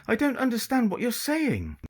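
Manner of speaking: put-on girl faking an English upper-class accent to a pirate. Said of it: "in her best English upper-class accent".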